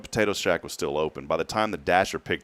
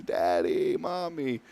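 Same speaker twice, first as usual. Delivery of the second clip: lightly voiced little girl, higher and soft; little daughter; 5 semitones higher, 4 dB quieter, much slower than usual. Sleepy daughter comes looking for her parents, imitated small-child voice. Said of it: "she comes walking into the office".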